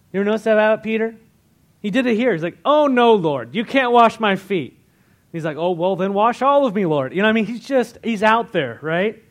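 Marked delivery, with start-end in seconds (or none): 2.6-4.8 loud, high-pitched mock objection; emphatic protest. Acting out Peter objecting to Jesus. 5.6-7.1 loud, higher, acted eagerness; eager reversal. Acting out Peter's eager reversal.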